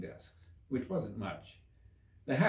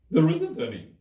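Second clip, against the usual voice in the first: high, much louder hare voice; March Hare voice; 7 semitones higher, 12 dB louder than usual. A narrator's reading. March Hare answering Alice, high and loud. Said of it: "said the March Hare".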